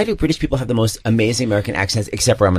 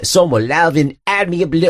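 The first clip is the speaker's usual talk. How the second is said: higher, exaggerated Liverpool babble; mock Scouse accent; 6 semitones higher than usual. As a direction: parody a thick Scouse accent. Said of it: "I landed in Liverpool".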